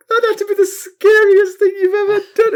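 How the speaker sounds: very high, loud, cracking-up mock sympathy; imitating suppressed laughter. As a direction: squeal out sympathy while losing control. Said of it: "she's trying to be sympathetic".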